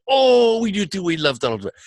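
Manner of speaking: high, gushing Italian manager; Italian night manager. Retold or imitated. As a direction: imitate an Italian bursting with enthusiasm. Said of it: "the night manager is Italian".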